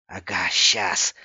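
scoffing refusal. A narrator's reading. Friend scoffs at the warning.

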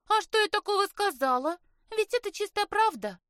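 high, loud protest of innocence; feigned innocence. A narrator's reading. Sister defending her remark to mother and sister, innocently indignant. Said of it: "Сестра в ответ лишь невинно захлопала ресницами".

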